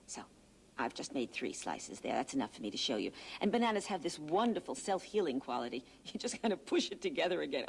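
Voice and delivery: ordinary delivery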